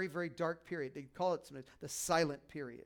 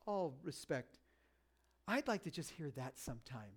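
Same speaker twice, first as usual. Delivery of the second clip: normal talking voice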